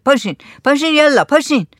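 insistent get-up order; urging command. A narrator's reading. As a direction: hurry them onto their feet. Server orders villagers to get up.

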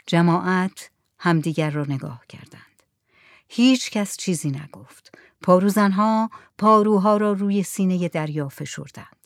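ordinary delivery, nothing marked

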